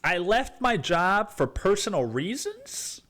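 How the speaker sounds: high, loud mock disbelief; over-the-top disbelief; mock surprise. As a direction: shriek it in fake shock.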